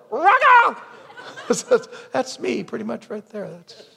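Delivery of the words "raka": high, loud cawing shout; crow squawk. Acted. Preacher showing himself as crow man, squawking shout. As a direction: caw the insult like a crow. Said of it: "Like a crow".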